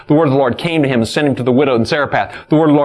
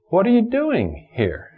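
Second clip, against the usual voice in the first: slow question, punching "doing"; stress on DOING; 9 semitones higher than usual. Shown as a demonstration. Preacher asks God's question of Elijah, stress on DOING. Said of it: "emphasize a different word".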